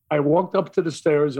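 ordinary delivery

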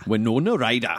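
guest tries Winona Ryder in Geordie; a copied Geordie Winona Ryder; Geordie accent. Shown as a demonstration. Guest echoes the Geordie warm-up, light Geordie accent. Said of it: "There you go, you're a Geordie".

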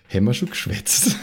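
laughing. Real, not acted. Player asking again, laughing. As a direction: question half laughed.

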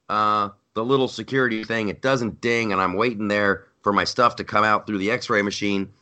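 usual speaking voice

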